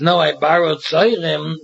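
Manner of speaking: normal talking voice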